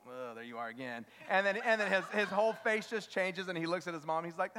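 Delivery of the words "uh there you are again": low, quiet grumble of acknowledgment; mock a small boy's underwhelmed greeting; low and soft, dismissive; unimpressed child. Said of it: "he gives me his little look".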